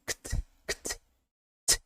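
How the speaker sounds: "ct" as bare voiceless clicks of k and t; tiny crisp "ct", just k then t; consonants only. Shown as a demonstration. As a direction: release "ct" with no vowel at all. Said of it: "Cuidado com a pronúncia aqui".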